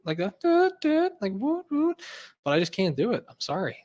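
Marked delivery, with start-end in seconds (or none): 0.4-1.1 much higher, botched doot doot call, coming out wrong; failed woot cheer. Shown as a demonstration. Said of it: "I can't do a woot correctly". 1.3-2.0 much higher woot woot cheer that still feels off; a high hesitant woot woot; woot cheer attempt. Shown as a demonstration. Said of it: "I can't do a woot correctly".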